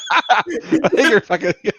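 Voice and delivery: laughing through the words; cracking up mid-sentence. Real, not acted.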